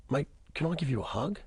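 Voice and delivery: soft, gentle question; gentle offer. Retold or imitated. The boss's wife softly asks a broken man for a hug. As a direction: ask softly, as if afraid to scare him.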